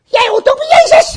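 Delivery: put-on very high, loud child's squeal; mock little-girl shriek. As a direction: pipe up accusingly as a tiny girl. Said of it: "En het meisje zegt".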